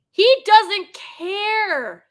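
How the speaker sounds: high, loud, drawn-out whine; wailing protest. Real, not acted. Fan objecting to a character's portrayal, high wailing protest. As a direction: stretch it into a pleading wail.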